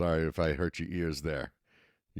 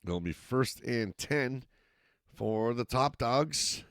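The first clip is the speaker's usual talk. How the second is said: steady live football call, rising with the action; play-by-play commentary; 3 semitones higher than usual. Acted. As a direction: call the game as it happens. Play-by-play resumes.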